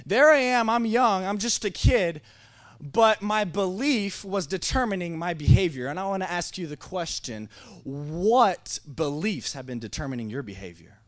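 high, loud, impassioned sermon delivery; forceful preaching. Real, not acted. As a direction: drive the point home to the congregation. Preaches the point at full force.

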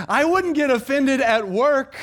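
high, loud, drawn-out protest; hammed-up excuse from an offended listener; mock complaint. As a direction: exaggerate the complaint, slow and high. Voicing an imagined complaining listener.

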